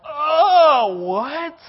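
high, loud oh, what; dismayed surprise. Acted. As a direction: caught off guard by the catch. Teen hearing the catch.

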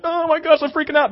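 very high, hammed-up panic; mock freak-out. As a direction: imitate someone losing it. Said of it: "not asking you to be like".

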